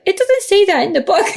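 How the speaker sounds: put-on high child voice through a laugh; imitating child. Mother imitating her daughter correcting her reading. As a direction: laugh while mimicking a little girl's protest.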